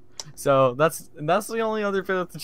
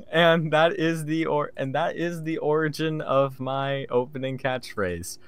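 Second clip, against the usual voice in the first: normal talking voice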